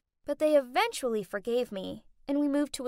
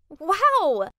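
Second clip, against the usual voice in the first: amazed wow; 4 semitones higher, 9 dB louder than usual. A narrator's reading. Amazed at country club.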